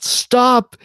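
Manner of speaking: sudden high, loud shout; imitated yell. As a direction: yell it out as an urgent command. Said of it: "he just immediately yelled".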